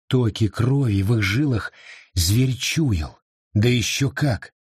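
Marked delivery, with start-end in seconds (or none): none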